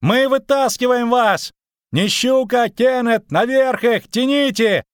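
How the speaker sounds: high, excited holler; sustained high-pitched urgent yelling; shouting from above. A narrator's reading. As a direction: shout orders to a hauling crew. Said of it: "крикнул сверху Лютик".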